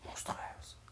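scary hushed storybook word; spooky whisper. Acted. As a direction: hush it like a storyteller's shiver. Bear describes the scary beast.

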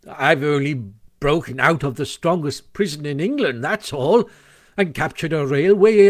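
false modesty building to high, loud bragging; puffed-up boasting. A narrator's reading. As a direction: swell with pride as the list grows. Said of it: "Toad was puffing and swelling already".

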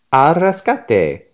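rallying cry. A narrator's reading. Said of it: "rescue catchphrase".

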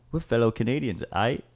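lightly attempted Canadian accent; mock Canadian with a questioning tag at the end. Podcaster imitating fellow Canadians flexing their roots, poor Canadian accent. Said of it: "You do the accent way better than me".

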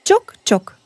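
high sing-song knock knock; playful knock imitation; knocking sound. A narrator's reading. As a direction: tap out the knock with the voice. Knock on the door.

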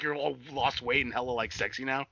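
ordinary delivery

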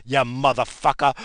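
ordinary talk

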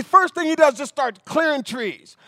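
ordinary talk, nothing marked

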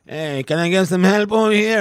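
lightly voiced business partner asking for help; imitating Russian friend. Retelling the tire shop, imitating the ignored partner. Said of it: "and he's like".

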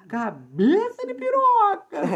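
high, slow, stretched cabeça de piroca with a falling end on piroca; sing-song the insult up high and drag piroca out; drawn-out insult intonation. Shown as a demonstration. Friend demonstrating the right insult intonation, high and drawn out. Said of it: "tem que ser com essa entonação".

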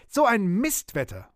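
high, indignant outburst; high-pitched scolding exclamation; cursing. A narrator's reading. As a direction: rail against the foul weather. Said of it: "schimpfte wie ein Rohrspatz".

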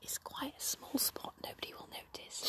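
unvoiced stage whisper. Real, not acted. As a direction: whisper it as an aside. Stage whisper about spot.

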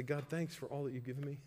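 softly voiced thanks to God; soft, humble prayer of thanks; quiet thankful prayer. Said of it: "go to the Lord with that emotion and say".

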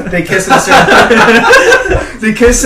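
slow giggly words; laughing while talking. Real, not acted. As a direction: drag it out laughing.

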